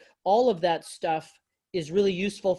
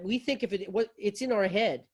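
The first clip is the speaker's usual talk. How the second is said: usual speaking voice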